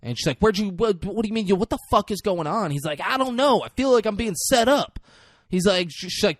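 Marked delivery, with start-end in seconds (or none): 0.4-2.7 angry demand. Retold or imitated. Retells angry confrontation over missing boy. 3.0-5.0 high, loud protest of innocence; panicked denial. Retold or imitated. Retells accused man's panicked denial.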